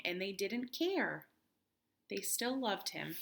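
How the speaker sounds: ordinary delivery, nothing marked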